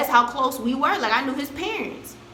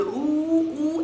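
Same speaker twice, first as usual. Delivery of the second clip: mock classmates' ooh; 4 semitones higher than usual. Classmates reacting to a fight.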